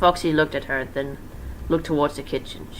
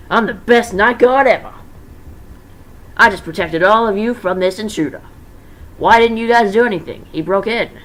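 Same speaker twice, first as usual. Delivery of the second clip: loud, higher bragging guard; boastful excitement; 6 semitones higher, 8 dB louder than usual. Acted. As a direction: crow about your victory. Mike boasting after the hit.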